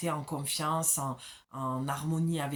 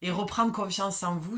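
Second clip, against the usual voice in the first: normal talking voice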